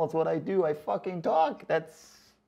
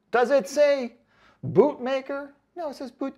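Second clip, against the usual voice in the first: higher, louder indignant retort; 10 semitones higher, 5 dB louder than usual. Real, not acted. Answering a rude commenter, indignant and raised. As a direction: set the commenter straight, worked up.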